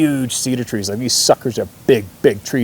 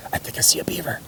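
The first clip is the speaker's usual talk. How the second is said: urgent hunter's whisper, higher pitched; 8 semitones higher, 8 dB quieter, faster than usual. Retold or imitated. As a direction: whisper it so the game won't hear. Kid whispers during sneaky hunt.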